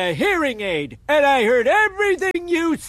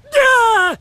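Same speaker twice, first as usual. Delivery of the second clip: loud duh; 10 semitones higher, 6 dB louder than usual. Acted. Grandpa's loud exclamation.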